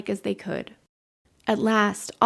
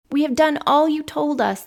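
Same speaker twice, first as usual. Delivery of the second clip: louder, higher eager call; 6 semitones higher, 6 dB louder than usual. A narrator's reading. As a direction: shout it across the yard. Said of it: "the children called".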